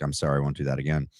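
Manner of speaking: ordinary talk, nothing marked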